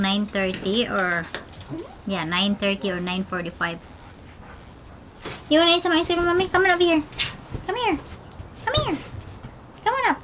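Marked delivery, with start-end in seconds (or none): 5.5-10.2 high sing-song coaxing; very high cooing to the little dog. Real, not acted. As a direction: coax the puppy up sweetly. Baby talk to the dog.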